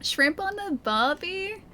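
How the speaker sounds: high, slow, rising question; questioning stock phrase. Shown as a demonstration. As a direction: draw it out upward in doubt. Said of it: "That has to be prawn on the barbecue".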